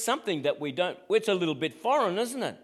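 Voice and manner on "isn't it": usual speaking voice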